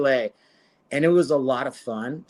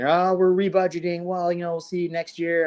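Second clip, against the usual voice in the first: higher, breezy corporate voice imitating a sponsor; mock sponsor brush-off; 6 semitones higher than usual. Fighter imitating sponsors who drop him after a loss, higher and breezy. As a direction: lightly play the sponsor letting him down easy.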